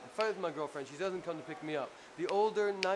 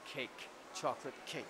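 soft, low relish of the cake; quiet, lower, savoring the chocolate cake; savoring murmur; 5 semitones lower, 9 dB quieter than usual. Retold or imitated. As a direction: murmur it like you're tasting it.

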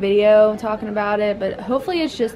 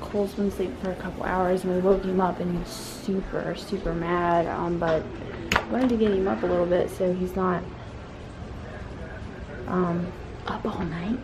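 hushed talk; 7 dB quieter than usual. Real, not acted. Mom quietly updating viewers in the evening, hushed.

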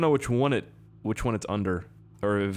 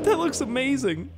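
much higher, amazed squeal; high squeaky voice; 14 semitones higher than usual. Real, not acted. Admiring the limo, a high squeaky voice. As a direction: let the voice crack up high in awe. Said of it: "Why did my voice go so high?".

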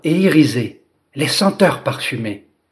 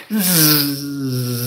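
buzzing; 4 dB quieter than usual. Acted. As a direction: hum like wings beating. Dragonfly flying fast.

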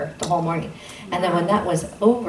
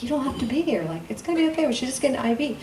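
mock reassurance; 7 semitones higher, faster than usual. Reassuring friend he needn't come.

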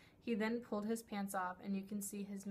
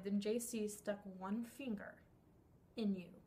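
ordinary talk, nothing marked

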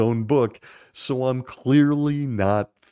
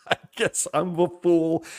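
«I guess I'm a fool» through a laugh, a bit higher; 5 semitones higher than usual. Real, not acted. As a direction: laugh out the word «fool» in disbelief. Repeats it laughing.

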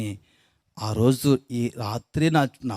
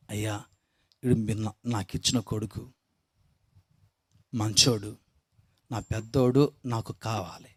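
tearful prayer; 5 semitones lower, 7 dB quieter than usual. Retold or imitated. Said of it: "ఏడుచుకుంటూ ప్రార్థన చేసింది".

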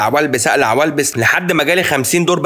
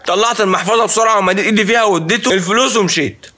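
ordinary delivery

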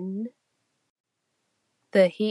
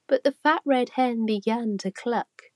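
plain reading voice

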